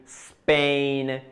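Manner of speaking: SPAIN starting straight on a held S; pure initial S. Shown as a demonstration. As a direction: hiss the S of "Spain" with no E before it. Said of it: "palabras que empiezan por S".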